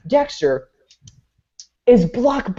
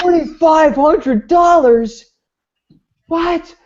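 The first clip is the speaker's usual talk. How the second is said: high, slow shocked shout over the bill; 13 semitones higher, 6 dB louder, much slower than usual. Retold or imitated. Customer shouting in shock at the receipt price. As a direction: yell in disbelief at the price.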